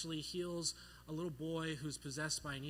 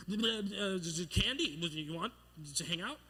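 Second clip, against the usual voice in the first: tongue-tied, stumbling over the words; bumbling; 2 semitones higher, 3 dB louder, slower than usual. Retold or imitated. Second-grader clumsily asking out his crush, bumbling. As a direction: trip and stutter through it. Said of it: "me bumbling through the words".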